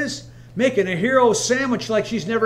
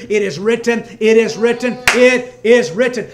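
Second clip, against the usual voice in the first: rising, louder with each repeat; building refrain; 8 dB louder than usual. Acted. Preacher hammering the refrain to the church, building louder. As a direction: drive it home harder each time.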